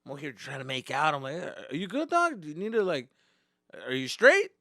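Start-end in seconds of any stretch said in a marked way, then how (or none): 1.7-4.5 high, loud worried question; alarmed check-in. Retold or imitated. To his swerving friend, loud worried question. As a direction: call up to the driver, alarmed. Worried asking drunk driver.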